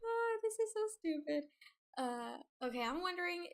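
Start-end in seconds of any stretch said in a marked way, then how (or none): 0.0-1.4 high voice just after a laugh; embarrassed giggle. Real, not acted. Streamer laughing at herself as stupid, embarrassed high giggle.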